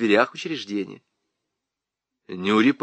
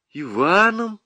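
higher, mildly dejected answer; glum; 8 semitones higher, slower than usual. A narrator's reading. Nyura answers the teasing lieutenant, mildly glum. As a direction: answer with a touch of gloom. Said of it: "уныло сказала Нюра".

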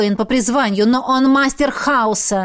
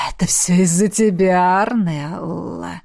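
slow, sinister whisper; 4 semitones lower than usual. A narrator's reading. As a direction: whisper the blame, drawing out the name. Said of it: "шептал Хаос". (зловещий шёпот)

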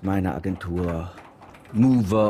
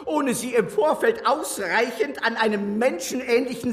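laughing; 12 semitones higher than usual. Acted. [name] lacht sich in Rage.

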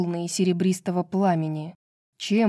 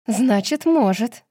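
higher, louder irritated comeback; snapped retort; 4 semitones higher, 4 dB louder, slower than usual. A narrator's reading. Nesta contradicts Azriel's disbelief, snapping back. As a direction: snap back, contradicting him sharply. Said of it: "огрызнулась Неста".

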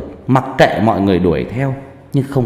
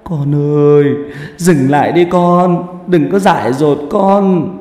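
high, loud wail of a pleading relative; acted tearful cry, high and slow; sobbing plea; 6 semitones higher, 5 dB louder, slower than usual. A narrator's reading. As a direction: beg desperately, drawing the words out. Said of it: "khóc nức nở".

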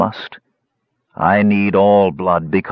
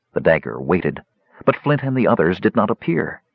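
usual speaking voice

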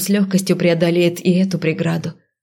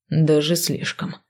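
slow, quiet, through gritted teeth; 3 semitones lower, 5 dB quieter, slower than usual. A narrator's reading. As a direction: squeeze it out through clenched teeth. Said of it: "процедил сквозь зубы Рентан". (сквозь зубы)

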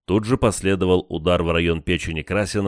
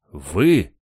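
surprised; 4 dB louder than usual. A narrator's reading. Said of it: "вытаращился на меня Красин".

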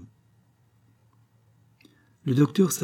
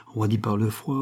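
ordinary delivery, nothing marked

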